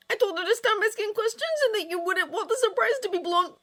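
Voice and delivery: very high, loud explaining voice; Fishlegs flustered. A narrator's reading. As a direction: gush the explanation in a squeaky character voice.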